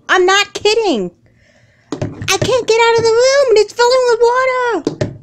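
very high, loud, panicked pleading; frantic screaming. Acted. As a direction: beg mom to believe you. Trapped in flooding room.

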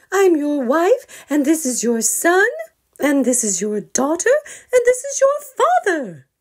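loud, high pointing out each one; exasperated listing. Acted. Mother bear naming family to her husband, loud and exasperated. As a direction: spell it out to him.

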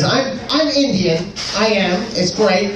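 ordinary talk, nothing marked

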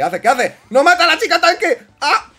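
laughing outcry. Real, not acted. Teammate kills tank girl.

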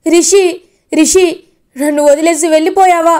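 loud, high wailing lament; crying out in grief. Retold or imitated. Father wailing over his son's body, loud grieving cry. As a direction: wail at him for leaving you, building to the last line. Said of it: "గట్టి గట్టిగా అరుస్తూ ఏడుస్తూ".

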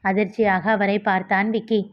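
usual speaking voice

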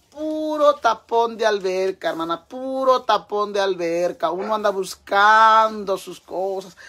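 loud, high, drawn-out wail; hammed-up lament. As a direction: wail the complaint slowly and theatrically. Queja exagerada a su amiga. (lamento exagerado, agudo y alargado)

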